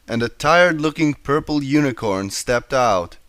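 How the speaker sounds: ordinary delivery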